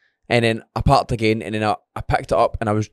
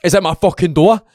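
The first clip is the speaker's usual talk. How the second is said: startled angry yell; 7 semitones higher, 6 dB louder, much slower than usual. Retold or imitated. Retold scare at the front door.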